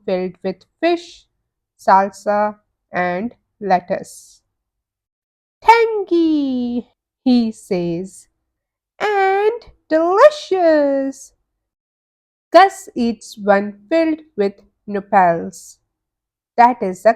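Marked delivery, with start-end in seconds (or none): 5.6-11.3 savoring taco. Acted. Pete tasting a fish taco.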